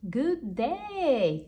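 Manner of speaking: loud, high pompous gentleman; cried greeting. Acted. Customer greets the shoemakers, loud and high. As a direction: boom out a grand hello. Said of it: "cried the customer".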